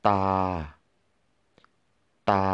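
ตา on a level flat tone. Shown as a demonstration. Teacher modelling ตา for learners, flat tone. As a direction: keep ตา steady in pitch. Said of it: "flat tone".

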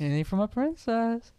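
high, sung-out phrase; musical phrasing. Retold or imitated. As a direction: shape it like a melody. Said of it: "he treats his lines like musical phrases".

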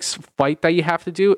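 ordinary talk

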